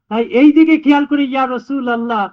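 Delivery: ordinary delivery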